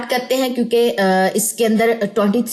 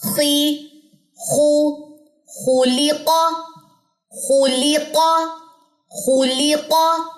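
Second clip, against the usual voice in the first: slow, measured recitation with held vowels; Quranic letter drill; 4 semitones higher, much slower than usual. Shown as a demonstration. Teacher modelling letters for students, slow intoned recitation. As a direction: intone each syllable on a steady, lengthened note.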